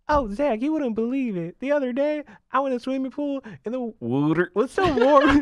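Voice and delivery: high, sweet Southern voice; sweet Southern friend. Retold or imitated. Atlanta friend telling Zach news, sweet high Southern accent. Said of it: "she has the sweetest voice".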